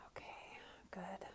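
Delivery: soft unvoiced whisper; hushed whisper after the breath. Real, not acted. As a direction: barely breathe the words after the exhale.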